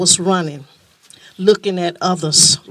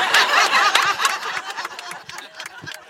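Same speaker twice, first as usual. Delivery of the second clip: laughing; 14 semitones higher, 3 dB quieter than usual. Real, not acted. Speaker laughs after story.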